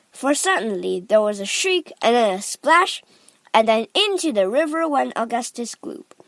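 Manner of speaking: plain reading voice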